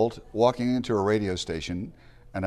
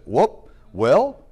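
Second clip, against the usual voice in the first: higher, louder mock-startled reaction; retold startled owner; 6 semitones higher, 7 dB louder than usual. Owner answering a determined teenager, mock-startled and higher. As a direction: act out the owner caught off guard. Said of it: "And the owner said".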